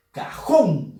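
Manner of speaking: "Cajón" leaning hard on "jón"; stress on last syllable. Shown as a demonstration. Teacher repeating the example for students, stress on "jón". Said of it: "Fuerza de voz en la sílaba jón".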